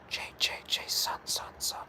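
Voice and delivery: whispered, stretched-out name; creepy whisper. Pretend. Streamer whispers Jason's name like a creepy animatronic. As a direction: hiss the name like a horror game.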